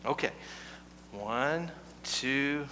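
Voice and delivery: normal talking voice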